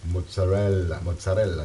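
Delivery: mozzarella rolled the Italian way, mots-a-REL-la; Italian pronunciation. Shown as a demonstration. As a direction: give mozzarella a proud Italian sound. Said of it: "I am Italian".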